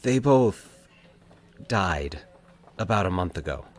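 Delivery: halting. A narrator's reading. Mike haltingly telling Valerie his parents died. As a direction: stop and start as if it hurts to say. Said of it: "he explained haltingly".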